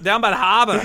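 put-on Boston dwarf voice; Boston accent. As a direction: echo it with Boston's dropped Rs. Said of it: "Dwarves are from Boston now".

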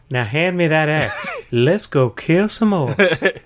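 slow-drawling Texan orc; grandpa-style Texas drawl as an orc; Texan accent. Acted. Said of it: "Orcs are Texan".

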